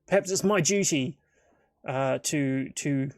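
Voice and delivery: lightly voiced, hesitant adventurer; Lothar. Adventurer reluctantly offering to help end a minotaur, lightly voiced and halting. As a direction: think it through aloud, feeling for the words. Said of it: "Lothar goes".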